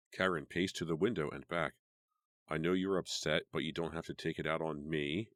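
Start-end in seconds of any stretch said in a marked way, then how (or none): none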